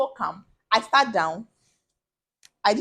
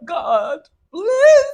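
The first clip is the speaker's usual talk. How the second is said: loud wailing plea to God, breaking into tearful laughter; desperate shouted prayer between sobs; crying prayer; 8 dB louder, much slower than usual. Retold or imitated. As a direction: cry out high and loud, half sobbing. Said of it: "That I'll be crying like".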